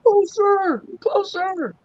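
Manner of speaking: closer said high and longing; high eager pleading. Retold or imitated. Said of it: "they're probably going".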